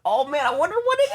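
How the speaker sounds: hammed-up eager curiosity; mock excitement. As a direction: gush like a giddy kid. Excited about surprise.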